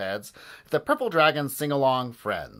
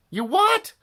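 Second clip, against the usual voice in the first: very high, loud squawk; shocked cry; 8 dB louder than usual. A narrator's reading. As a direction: cry out in disbelief. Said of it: "cried Harold".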